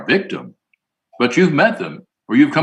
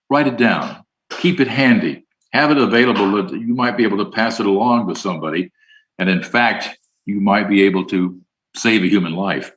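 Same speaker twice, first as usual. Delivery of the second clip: normal talking voice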